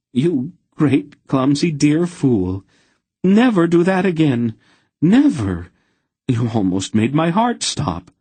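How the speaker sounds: high, loud, fervent pleading; overwhelmed relief spilling out; emotional outburst. A narrator's reading. Aunt Paul embraces the smith, crying out high and loud.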